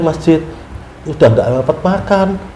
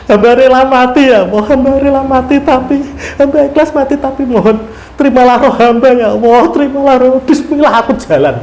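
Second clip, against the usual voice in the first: higher, surrendering plea; fervent prayer; 7 semitones higher than usual. Retold or imitated. Pilgrim offering his life to God, rising fervent plea. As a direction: rise into a heartfelt vow. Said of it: "saya sempat menangis waktu itu".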